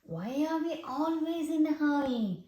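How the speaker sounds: ordinary delivery, nothing marked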